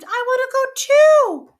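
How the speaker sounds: put-on high, loud girl's cry after the balloon; desperate scream. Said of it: "she screamed".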